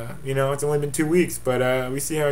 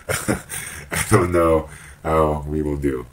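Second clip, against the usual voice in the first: much lower, slow filtered voice with a laugh; slowed-down deep voice changer; deep slowed voice effect; 9 semitones lower, 3 dB louder, much slower than usual. Shown as a demonstration. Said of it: "female to male".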